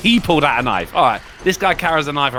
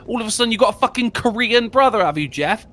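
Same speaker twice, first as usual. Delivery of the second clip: fast, higher, mocking question; teasing jab; 8 semitones higher, faster than usual. Real, not acted. Streamer teasing Jeff about his brother's name, quick and mocking. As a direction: rib the character for the odd name. Teases the story character.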